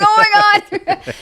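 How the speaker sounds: drawn-out, high wail through laughter; mock wail. Trainee told to shut up and go, mock wailing. Mock crying after sergeant snaps.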